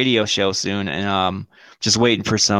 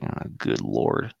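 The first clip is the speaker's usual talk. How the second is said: very low, slow groaned aside; mock dread; 7 dB quieter, much slower than usual. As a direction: groan it under your breath, drawn out. His dread at meeting ex.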